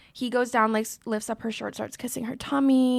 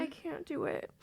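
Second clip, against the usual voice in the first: high, very soft, real upset; small voice on the verge of crying; overwhelmed, barely audible; near tears; 4 semitones higher, 13 dB quieter than usual. Real, not acted. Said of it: "I feel like I'm gonna cry".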